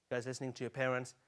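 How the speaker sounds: ordinary talk, nothing marked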